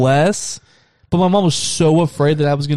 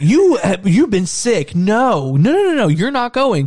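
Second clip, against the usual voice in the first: higher-pitched mock scolding, rapid-fire noes; imitated indignant mom; 7 semitones higher, slower than usual. Mom tells sick son no haunted house, mock indignation. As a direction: act out a mother's exasperated refusal. Mother refusing, acted out.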